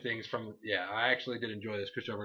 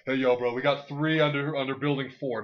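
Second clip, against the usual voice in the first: louder, pushy bro calling orders; bossy gamer; 3 semitones higher, 8 dB louder than usual. Retold or imitated. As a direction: bark orders like an overeager squad leader. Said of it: "the guy on cod who's like".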